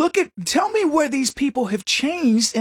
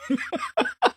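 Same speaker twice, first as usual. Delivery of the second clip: sudden falsetto cackle; high squealing laugh; 5 dB quieter than usual. Real, not acted. Laughing at own impression.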